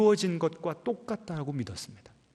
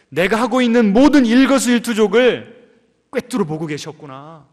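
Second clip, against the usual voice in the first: loud, higher, building awe; awed realisation; 4 semitones higher, 7 dB louder than usual. Acted. Preacher voicing Isaac's awe, building loud. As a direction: marvel that God saw it all.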